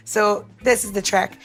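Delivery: usual speaking voice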